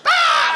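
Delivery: very high, loud scream; startled shriek. Retold or imitated. Man at dinner hears the kid's answer, high shocked shriek.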